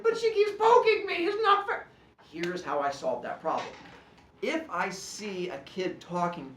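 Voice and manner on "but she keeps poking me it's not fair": put-on high, loud whiny kid; whiny complaint. Addison protesting to the teacher, high loud whine. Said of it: "Addison's like".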